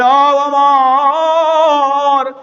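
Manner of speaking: high, long-drawn sung cry; calling out. Retold or imitated. Mother calling out to Omar, long-drawn melodic call. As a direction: call the name out high and stretch it far. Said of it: "মহিলা ডাক দিয়া বলেন".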